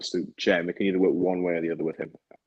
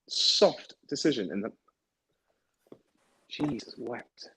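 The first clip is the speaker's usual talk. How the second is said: exasperated at referee; 5 semitones higher than usual. Real, not acted. Ranting at the referee mid-match.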